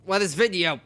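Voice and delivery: commanding police detective; detective giving orders. Acted. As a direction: order the officers about firmly.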